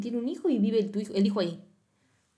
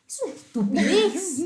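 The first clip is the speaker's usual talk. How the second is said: drawn-out, high, loud exasperation; slow, pitched-up scoff at a silly example; exasperated outburst; 9 semitones higher, 5 dB louder than usual. Real, not acted. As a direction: spit it out in disbelief.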